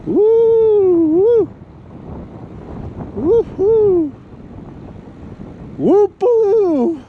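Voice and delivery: high, loud whoops into the gusts; gasping in icy wind. Real, not acted. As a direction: whoop out against a freezing gust.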